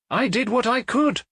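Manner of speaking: landlady's indignant last word; defensive. A narrator's reading. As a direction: close the subject sharply.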